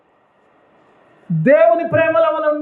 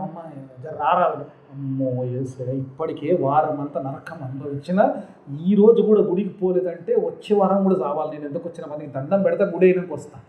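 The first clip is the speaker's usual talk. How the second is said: mock weary churchgoer; 7 semitones lower, 4 dB quieter than usual. Voiced tired churchgoer excuse.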